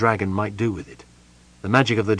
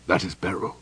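throat constricting; 3 semitones lower, 5 dB quieter than usual. A narrator's reading. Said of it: "his throat constricting".

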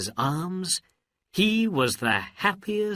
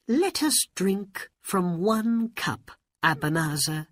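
sweet princess voice; 9 semitones higher than usual. Acted. Princess tricks magician.

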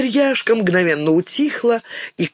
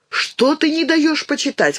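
indignant; 9 semitones higher than usual. A narrator's reading. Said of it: "возмутилась мышка".

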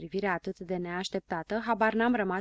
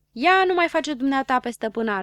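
higher, louder rude retort; rudely; 6 semitones higher, 8 dB louder than usual. Acted. Boy snapping rudely at the poet, loud. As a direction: snap back at her, rudely. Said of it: "răspunse Habar n-am cu grosolănie".

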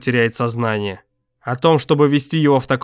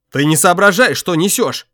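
loud, bitter fierce rebuke; 5 semitones higher, 3 dB louder than usual. Acted. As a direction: snap at him furiously. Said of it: "ожесточённо сказал Гюрза".

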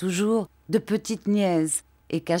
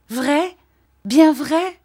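Dora ravie; 9 semitones higher, 8 dB louder, much slower than usual. A narrator's reading.

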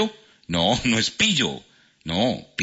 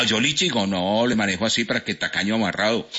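ordinary talk

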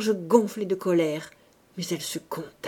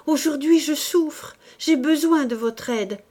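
higher, louder plea; cried out; 6 semitones higher, 4 dB louder than usual. A narrator's reading. Woman crying out to the priest for help. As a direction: cry out in anguish. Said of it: "cria-t-elle".

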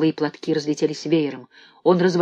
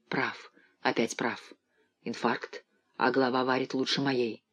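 put-on low, soft, frail old voice; feeble old man; 4 semitones lower, 9 dB quieter than usual. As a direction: speak like a dying old man. Weak old Dussander speaking.

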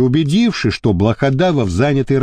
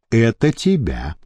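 lower, slow and smug, savouring it; smug grin; 10 semitones lower, 4 dB quieter, slower than usual. A narrator's reading. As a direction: hand over the phone with a gloating grin. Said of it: "с довольной ухмылкой".

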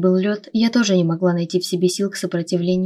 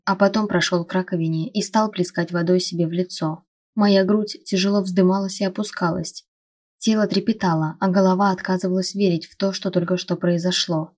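plain reading voice

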